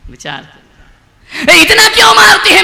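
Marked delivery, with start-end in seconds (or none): 1.5-2.6 high, loud demanding ask; shouted question. Acted. Preacher voicing the complaint, shouting. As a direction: fling it out indignantly.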